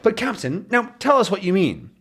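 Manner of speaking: high, fussy detective voice; high Thompson voice. Acted. Thompson questions Captain's calculations, high and fussy. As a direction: pitch it up like a prim detective.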